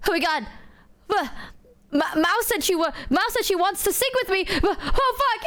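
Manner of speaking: high, fast panicky squeal; imitating freaked-out friend. Imitating Numi panicking that Mao wants to sing, high and rushed. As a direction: act out her panic, rushing and high.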